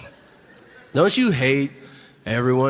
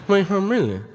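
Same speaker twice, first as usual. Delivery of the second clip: higher, garbled Cajun mumble; unintelligible Cajun; 4 semitones higher than usual. Retold or imitated. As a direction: mimic a Cajun running the words into mush. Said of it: "decipher that what he's saying is".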